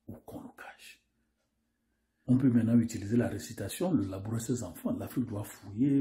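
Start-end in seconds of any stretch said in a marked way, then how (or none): 0.1-1.0 hushed, secretive whispered question; mysterious whisper. Acted. Narrator whispering a mysterious question to the audience. Narrator hints at a hidden truth.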